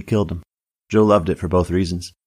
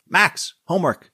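calling out; 10 semitones higher than usual. Acted. Waitress calls her son to his homework, high call. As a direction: call a child to his task, pitched high.

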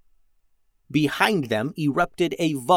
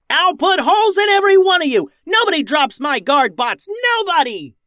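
very loud, high battle rage; furious yell; 6 dB louder than usual. A narrator's reading. Max yells at Goob's men, furious battle cry. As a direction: scream threats down the hall at the enemy. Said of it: "yelled Max".

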